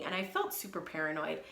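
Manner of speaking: normal talking voice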